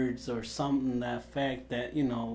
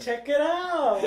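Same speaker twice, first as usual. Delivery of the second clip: high, loud, playful holler; hammed-up celebratory shout; mock hype; 12 semitones higher, 5 dB louder, slower than usual. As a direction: show off with exaggerated excitement. Mock cheer at tiny audience.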